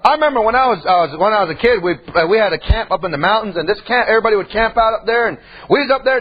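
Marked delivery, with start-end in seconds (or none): none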